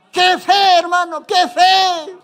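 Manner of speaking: high, loud cry of wonder; amazed exclamation. Real, not acted. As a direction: marvel aloud at such faith. Preacher exclaims at the faith. (exclamación asombrada y fuerte)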